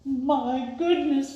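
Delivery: slow, startled exclamation; boy startled by the dog; surprised cry. A narrator's reading. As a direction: drag it out in shock. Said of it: "he cried".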